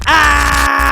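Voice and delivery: loud high shriek of fear; terrified scream. Real, not acted. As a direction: shriek in terror. Creature transforms.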